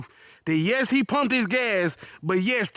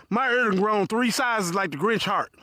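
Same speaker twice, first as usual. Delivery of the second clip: usual speaking voice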